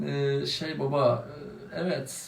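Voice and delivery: soft, slow, hesitant mumble; stall, searching for words; sheepish stammer. Acted. Caught son mumbles an excuse to father.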